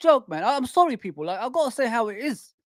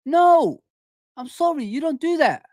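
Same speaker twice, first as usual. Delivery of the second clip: emphatic refusal; 7 semitones higher than usual. Real, not acted. Fan rejecting special treatment for a player, emphatic refusal.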